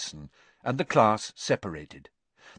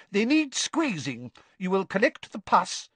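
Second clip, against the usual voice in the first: high, brisk schoolmistress; bossy teacher, pitched high; 10 semitones higher than usual. A narrator's reading. Voices Professor Sprout.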